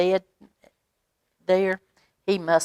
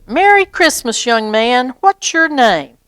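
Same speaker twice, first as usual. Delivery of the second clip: much higher, louder jolly Santa greeting; 6 semitones higher, 4 dB louder than usual. Acted. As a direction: greet the boy like a cheerful Santa. Santa practices greeting child.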